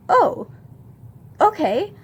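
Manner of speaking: high-pitched, taken-aback acknowledgement; awkward surprise. Retold or imitated. Retells awkward reply to love confession.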